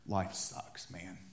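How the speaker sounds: low, hushed defeated sigh of words; mock dejection. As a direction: mutter it like you've given up. Acting a downcast reply to greeting.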